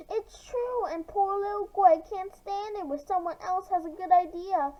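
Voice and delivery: high, scornful jeering; angry taunt. Acted. Mara taunts Greg.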